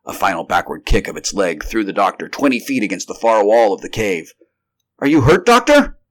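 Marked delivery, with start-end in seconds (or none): 5.0-6.0 high, loud anxious question; alarmed cry. A narrator's reading. Carnes to the doctor thrown against the wall, alarmed cry. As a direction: call out urgently to an injured friend. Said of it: "cried Carnes".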